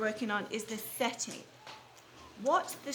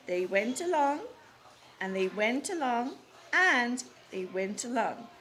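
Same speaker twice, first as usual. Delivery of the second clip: storytelling rhythm; 3 dB louder than usual. A narrator's reading. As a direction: let the journey stretch on. Reads the story aloud.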